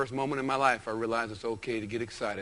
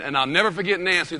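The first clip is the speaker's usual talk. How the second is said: ordinary talk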